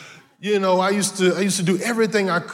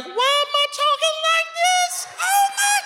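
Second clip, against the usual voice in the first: squeaky falsetto, far higher; voice cracking high. Retold or imitated. Starstruck boy meeting Michael Jordan, voice cracking high. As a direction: voice breaks up into a squeak. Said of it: "I started to talk high".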